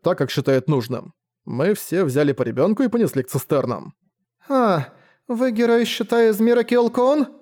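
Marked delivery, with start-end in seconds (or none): 4.5-7.3 put-on child voice. Child speaks to the hero.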